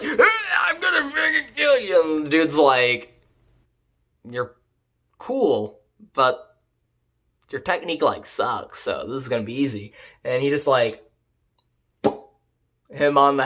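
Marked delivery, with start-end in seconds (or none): 0.0-2.2 high, strained mock war cry; hammed-up charging yell, pitched way up; mock battle cry. Hero charges slayer with hatchet, mock battle cry. 4.3-9.9 softer, halting, unimpressed delivery; mock cool dismissal. Slayer dismisses charging hero, mock nonchalance.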